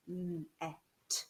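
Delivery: robot sounding out. Shown as a demonstration. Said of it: "the robot arms".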